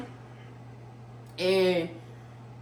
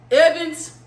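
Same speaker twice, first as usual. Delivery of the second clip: loud call, higher pitched; guard shouting a name across the cell block; 9 semitones higher, 9 dB louder than usual. Retold or imitated. Guard calling her name.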